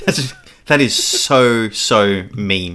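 slow, emphatic disapproval; appalled. Real, not acted. Reacting to a friend's cruel joke, appalled. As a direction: drag out each word, appalled.

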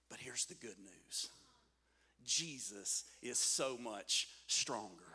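low, soft and slow; hushed reveal. Real, not acted. Preacher revealing the good news to the congregation, hushed and slow. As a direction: drop right down to a quiet, weighty delivery.

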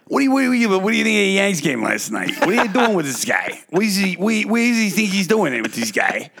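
high, fast New York guy ranting about the Yanks game; New York accent. Retold or imitated. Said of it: "Whether it's Southern or that".

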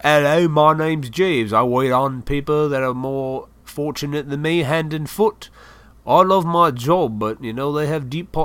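put-on a mild English butler accent. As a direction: play a posh English waiter introducing himself, in an English accent. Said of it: "an English accent".

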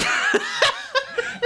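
squealing laugh; high laugh. Real, not acted. Laughs at own story.

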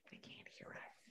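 whisper. Acted. Hushed aside about passersby.

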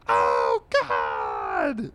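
low, loud cartoon bellow of agony; hammed-up pained shout. Goat hit in the head by dart, hammed-up bellow. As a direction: bellow it low, as if just struck.

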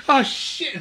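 high, startled "oh shit"; comic high-pitched panic as he's sucked into the portal; startled yelp. Retold or imitated. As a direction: squeak it out as if suddenly yanked upward. Character sucked into heaven portal.

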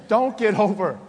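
normal talking voice

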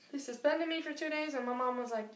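ordinary talk, nothing marked

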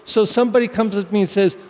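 ordinary talk, nothing marked

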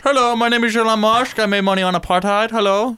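quick, slurred mock-accent impression; fast silly caricature; Elon Musk. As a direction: rattle it off as a goofy foreign billionaire. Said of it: "he's from a different country".

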